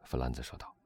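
plain reading voice

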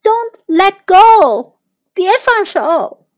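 high, slow, drawn-out call; pleading, stretched-out cry; urgent shout. A narrator's reading. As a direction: call out each word to a friend in danger. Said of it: "杰克也大喊说".

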